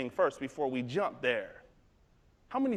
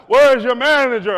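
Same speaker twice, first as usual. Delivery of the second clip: louder, high, insistent demand; hammed-up outrage; irate customer; 8 semitones higher, 17 dB louder than usual. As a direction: imitate a customer shouting for the boss. Angry customer demands manager.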